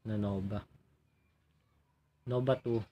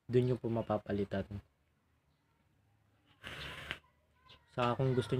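speaking through vape smoke; breath out after inhale; exhaling vapor while talking; faster than usual. Real, not acted. Seen 0: Vape lowered from mouth; thick vapor exhaled while speaking at start.

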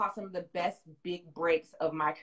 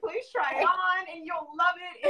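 giddy; 11 semitones higher, 5 dB louder than usual. Retold or imitated. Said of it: "I'm like".

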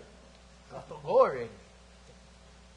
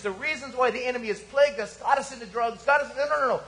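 loud, higher, driving sermon voice; full-force preaching; 4 semitones higher, 4 dB louder than usual. Acted. Pastor exhorts the congregation about their greatest need, preaching hard. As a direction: preach it hard to the back row.

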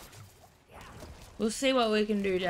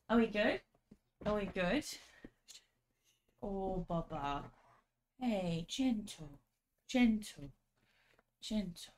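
softer coaxing voice to the dog; soothing; 2 semitones lower, 8 dB quieter than usual. Real, not acted. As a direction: settle the puppy gently.

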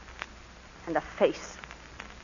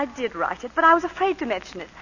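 ordinary talk

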